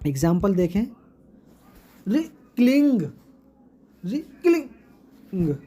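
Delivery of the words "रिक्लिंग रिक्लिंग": रिक्लिंग with cling stressed and stretched; short रि, then a long, weighted cling; stress on second syllable. Shown as a demonstration. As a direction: split रिक्लिंग slowly, leaning on cling. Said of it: "stressed करके बोलेंगे".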